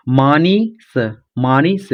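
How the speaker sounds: मानीस drawn out as maa-nee, then s; beginner's piecemeal reading of मानीस; sounded out. Shown as a demonstration. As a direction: read मानीस slowly in separate sounds, long first vowel. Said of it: "तो इसे ऐसे पढ़िए".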